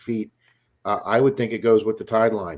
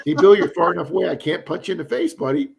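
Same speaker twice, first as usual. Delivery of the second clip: loud, raised-pitch playful threat; mock threat; 6 semitones higher, 5 dB louder than usual. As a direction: jokingly threaten a buddy, voice up. Joking threat over a teasing guest guess.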